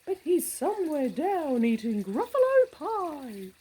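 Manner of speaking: high, loud hammed-up owl. The owl telling the child, in a high loud voice. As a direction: play the owl bragging.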